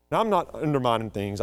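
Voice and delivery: ordinary talk, nothing marked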